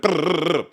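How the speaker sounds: rolled "brrrr" like a drum fill; rapid trilled drum noise; rushed drum-fill imitation. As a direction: blurt a drum roll with the mouth. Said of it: "so ahead of the beat and rushy".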